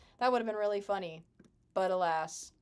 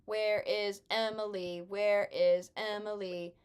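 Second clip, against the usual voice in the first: slow drawn-out calling; 3 dB louder, slower than usual. Real, not acted. Searching in game, drawn out.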